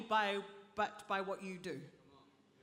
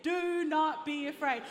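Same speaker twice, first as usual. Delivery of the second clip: loud, raised call to the congregation; rallying call; 4 semitones higher, 7 dB louder than usual. Real, not acted. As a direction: lead the crowd in saying it. Leading congregation to say it.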